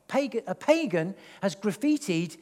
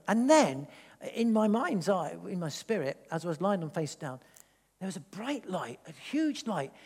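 normal talking voice

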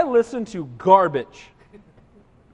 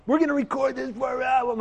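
rock star announcing; 5 semitones higher than usual. Retold or imitated. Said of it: "[name] gets up and goes".

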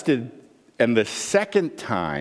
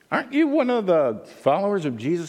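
put-on slave girl; 7 semitones higher, faster than usual. Slave girl questioning Peter.

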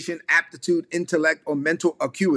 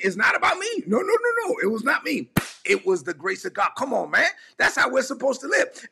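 high, excited preacher cry; fired-up preaching; 6 semitones higher than usual. Real, not acted. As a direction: lift it up with fervor. Preacher fired up, pitch soars.

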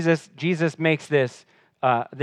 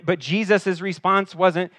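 normal talking voice

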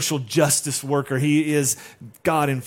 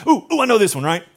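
eager, higher, louder blurt; eager Peter; 5 semitones higher, 6 dB louder, faster than usual. Acted. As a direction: jump in like an eager student. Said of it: "Peter, being the guy that always has to speak first".

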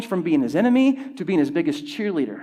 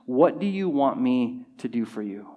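measured question, lower in pitch; deliberate question; 4 semitones lower than usual. Acted. Jesus asking the blind man, deliberate and measured. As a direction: ask it deliberately, letting each word land.

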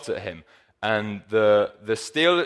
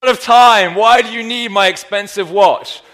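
retold plea to God, voice pitched up and louder; pleading prayer; 8 semitones higher, 9 dB louder than usual. Pastor retelling his friend's prayer, a louder, higher mock plea. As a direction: protest to God in mock dismay. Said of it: "He says".

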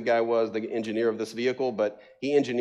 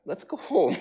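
a high, comic sigh of a plea; weary relief; 14 semitones higher than usual. Retold or imitated. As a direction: give up, half-laughing. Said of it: "looked at each other and said".

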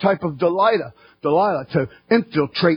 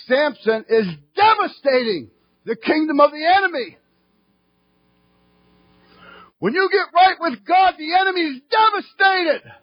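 full-force preaching; 10 semitones higher than usual. Acted. Preacher shouting to the congregation about the enemy. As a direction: raise the roof with a ringing cry.

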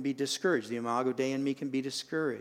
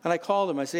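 ordinary talk

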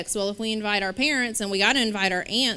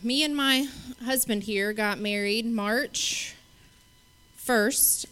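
usual speaking voice

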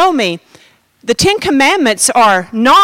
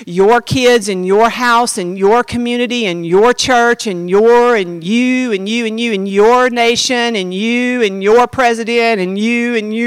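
slow, droning run of items; drawn-out piling list; 3 semitones lower, slower than usual. Retold or imitated. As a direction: stretch each item, on and on. Piles up God's list of self.

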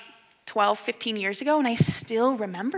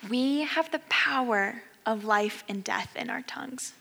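soft, slow sermon voice; quiet, earnest preaching; hushed preaching; 6 dB quieter than usual. Acted. Closing sermon exhortation.

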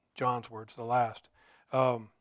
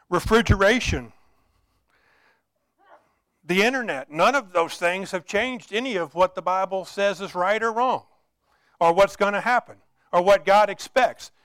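raised, insistent sermon delivery; impassioned preaching; 5 semitones higher, 10 dB louder than usual. Real, not acted. Preacher tells the congregation God is real today, impassioned preaching. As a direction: press each point home on a lifted pitch.

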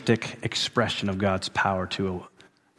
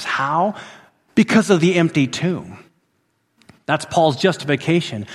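high, loud, full-force preaching; fiery preaching; 8 semitones higher, 5 dB louder than usual. Acted. Preacher to his congregation on resurrection power, full-force preaching. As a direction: proclaim it to the whole congregation.

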